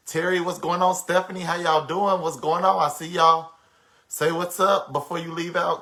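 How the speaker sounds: host greeting viewers loudly by name on the livestream; calling out greetings. Real, not acted. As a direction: call out to the chat by name.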